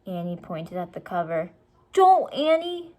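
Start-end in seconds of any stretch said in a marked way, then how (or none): 1.9-2.9 high, loud, slow plea; boy pleading with his sister; firm warning. A narrator's reading. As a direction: beg her to stop. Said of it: "said Jack".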